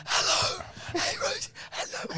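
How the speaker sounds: whispered, voiceless phone greeting, mimicking; mock a voiceless dad calling his daughter; imitating lost voice. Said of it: "You're whispering".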